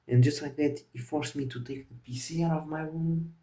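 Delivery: ordinary delivery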